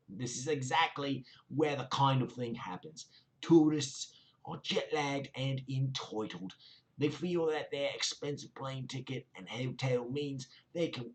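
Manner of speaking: a hammed-up South London accent mocking the commenter. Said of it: "my best South London accent".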